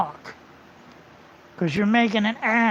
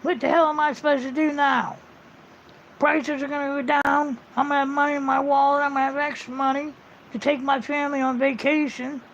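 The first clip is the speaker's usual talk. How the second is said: higher, put-on complaining voice, quick in places; sarcastic impression of a griping video maker; mocking a whiny critic; 5 semitones higher than usual. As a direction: lampoon his fake outrage. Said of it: "He said".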